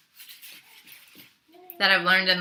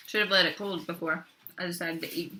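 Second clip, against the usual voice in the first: ordinary delivery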